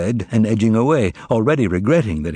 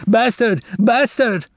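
screaming; 11 semitones higher, slower than usual. A narrator's reading. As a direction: hurl the insult down the boulevard. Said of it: "was screaming the length of the boulevard".

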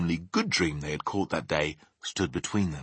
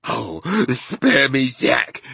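slow, raised, begging cry; pleading yell; 4 semitones higher, 4 dB louder, much slower than usual. A narrator's reading. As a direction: plead loudly for mercy. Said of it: "he yelled".

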